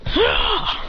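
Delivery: high short yell; battle cry. Acted. Kicking Hit, high battle cry. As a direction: bark the kick yell.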